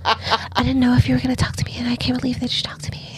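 fast, breathless-sounding fangirl whisper; awestruck whisper. Acted. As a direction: whisper in a rush, imitating a starstruck girl. Mimicking awestruck shy girls.

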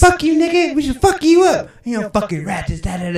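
high, shouted taunts that trail off; hammed-up girls threatening from bikes; mock threatening yell. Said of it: "They're like".

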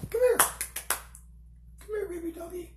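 high breathy coo to a dog. Real, not acted. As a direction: coo softly like to a puppy. Cooing to the dog.

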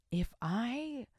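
unvoiced whisper of if I. Real, not acted. Host whispering the setup to listeners.

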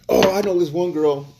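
high mocking imitation of women; mock a woman's high whiny voice. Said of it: "here is what the women are about to say".

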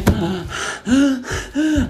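hammed-up gasping for air; acted breathless gasps; out-of-breath gasping. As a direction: pant as if winded from walking. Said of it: "I'm, like, out of breath".

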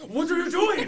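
high, loud mock outburst from an appalled instructor; shocked yell. Instructor catching student with pants down, shocked yell. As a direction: imitate a startled teacher shouting.

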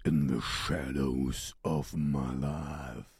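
low, slow, drawn-out intoning; deep, deliberate recital of song lines; dramatic lyric recital. Acted.

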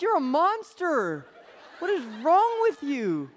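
high, loud, slow accusation; mock outraged yell. The boy raging at his mom over the collar, high and loud. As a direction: blast mom like a wronged kid.

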